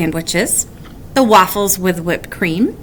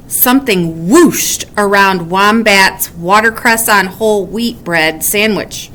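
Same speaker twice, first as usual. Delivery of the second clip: ordinary delivery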